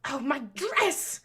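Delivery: high, loud, slow dismayed cry; cried out. A narrator's reading. Baroness crying out at tea spilled on her dress. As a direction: wail over the ruined dress. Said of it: "the Baroness cried".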